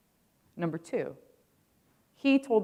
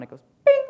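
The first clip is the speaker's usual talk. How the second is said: high loud mock computer ding; ping it out like an alert chime; imitated bing; 13 dB louder than usual. Mimicking the render-done alert to the audience, high loud bing.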